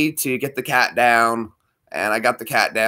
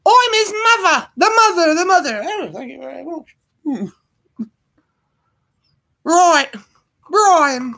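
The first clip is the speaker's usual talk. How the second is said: much higher, falsetto mock British mum; Python mother impression. Doing the Life of Brian mother for the stream viewers. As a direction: screech it like a scolding British mum in drag. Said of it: "not a stretch for you guys to do a British accent".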